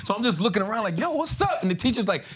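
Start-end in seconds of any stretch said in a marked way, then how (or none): 1.0-1.6 high, casual mock-innocent greeting; playing innocent. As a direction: act like you've done nothing. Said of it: "acting like I'm not doing nothing".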